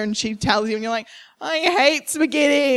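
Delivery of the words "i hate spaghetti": a slow, lightly voiced whining child; child refusing dinner to mum, slow whine; sulky kid whine. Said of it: "that big meltdown".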